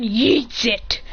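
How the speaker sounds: stretched-out, punchy action word; emphatic exclamation. A narrator's reading. Narrator describing a box being hurled, emphatic exclamation. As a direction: draw out the verb as if hurling something.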